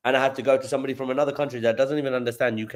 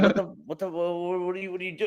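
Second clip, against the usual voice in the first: mock confused stammer, pitched higher; higher, hammed-up baffled what-what; 9 semitones higher, 3 dB quieter, faster than usual. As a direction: stumble over the words like you can't follow the caller. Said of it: "I'm gonna be like".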